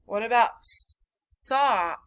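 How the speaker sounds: usual speaking voice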